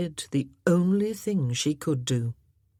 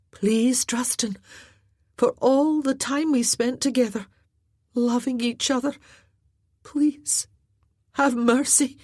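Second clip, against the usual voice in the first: higher pleading woman's voice; 7 semitones higher than usual. Acted. Glynis begging Druston for mercy, higher pleading voice. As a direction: beg him for mercy.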